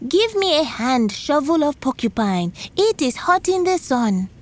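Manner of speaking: the spider imitating Porcupine's call to the shovel; spider shouting. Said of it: "shouted the same thing".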